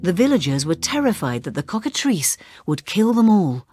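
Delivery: ordinary delivery, nothing marked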